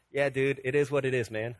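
low, casual dude shrug; mock-indifferent slacker reply; laid-back Superman. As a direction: lightly play an unbothered hero, pitch dropped. Voices a complacent Superman.

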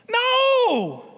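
a loud, high, ringing no; emphatic no. Real, not acted. Pastor answering his own question to the congregation, a loud high no. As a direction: shut the idea down hard.

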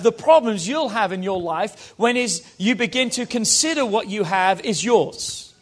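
normal talking voice

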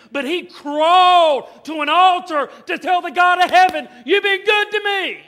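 put-on preacher shouting the boy's praise at full force; preaching shout. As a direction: belt it to the congregation. Preacher's climax retelling the boy.

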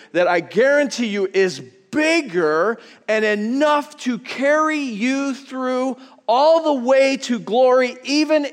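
preaching build. Real, not acted. Pastor assuring suffering believers, slow building preaching. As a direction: rise toward glory.

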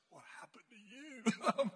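drawn-out mock punchline. Finishing his poem for the class, mock dismay.

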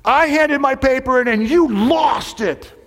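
high, drawn-out yell of accusation; imitating an angry student's shout; indignant student. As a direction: yell it slowly, high and outraged. Student yells accusation in retold quarrel.

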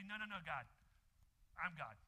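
mock-proud "I'm God" refrain; defiant boast. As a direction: push back at God like you run everything. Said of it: "We say".

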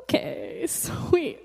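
soft, stunned okay, pitch jumping about; bemused acceptance. Retold or imitated. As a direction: play it weirded out but going along. Stunned reply to uncanny prophecy.

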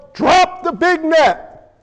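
slow, high, punched-out order; acted boss's shouted command; boss man yelling. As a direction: yell each word to the crew. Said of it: "boss man yells".

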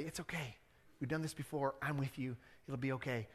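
soft, calm comforting friend; reassuring. Retold or imitated. Friend calming a scared buddy on a plane, soft and reassuring. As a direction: soothe a scared flyer.

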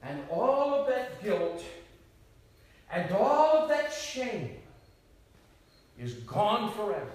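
high, loud and slow; soaring sermon climax. Real, not acted. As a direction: lift it to a triumphant peak. Climactic preaching.

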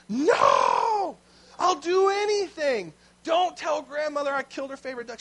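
loud, high, desperate plea; panicked begging. Retold or imitated. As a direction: beg her not to tell, in a panic. Boy panicking, begging sister.

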